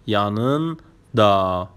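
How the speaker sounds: "yanında" slowly in two parts, yanın... da; split for learners. Shown as a demonstration. Teacher showing learners the word for beside, "yanında" split before da. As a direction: pause inside "yanında" before the drawn-out da.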